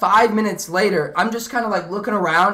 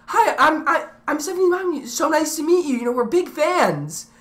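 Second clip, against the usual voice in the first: imitating Stephanie's mom; 6 semitones higher than usual. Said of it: "Stephanie's mom comes up to me and is like".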